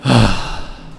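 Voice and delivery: long breath out after a scare; heavy sigh. Real, not acted. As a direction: let the tension out in a sigh. (derin iç çekiş)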